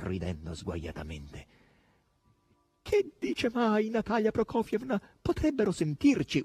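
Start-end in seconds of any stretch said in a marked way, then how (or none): 2.8-6.4 high, imploring boar voice; pleading howl. A narrator's reading. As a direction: beg in a high wail. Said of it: "urlò il verro in tono di implorazione". (urlo acuto di implorazione)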